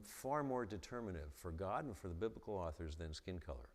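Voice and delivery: normal talking voice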